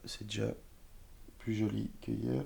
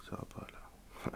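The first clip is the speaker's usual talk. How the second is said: whisper; 12 dB quieter than usual. Real, not acted. Embarrassed aside about no makeup.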